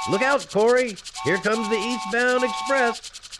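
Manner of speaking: put-on high, excited and boyish; playing choo-choo with a friend; playful train game.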